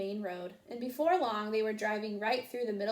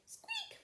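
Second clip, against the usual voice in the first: tiny squeak; 12 dB quieter than usual. A narrator's reading. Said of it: "said Mouse".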